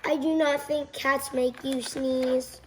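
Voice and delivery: ordinary delivery, nothing marked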